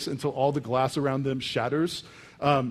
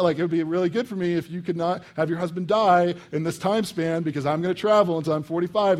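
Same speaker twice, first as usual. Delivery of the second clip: usual speaking voice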